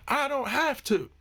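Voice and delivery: high, slow mimicry of a stroppy customer; mock defiance. Customer refusing to speak nicely to staff, mock defiant. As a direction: stretch it out in a mocking high voice.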